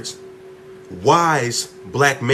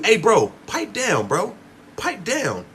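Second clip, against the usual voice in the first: high, slow mock of a scolding friend; high drawn-out mock hushing; mocking pipe down; 8 semitones higher, slower than usual. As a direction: imitate someone telling you to calm down, pitched up high. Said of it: "to tell you".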